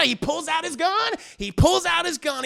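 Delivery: high, loud, thrilled recap; worked-up storyteller at the climax; excited narration. Real, not acted. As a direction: blurt the twist at high pitch.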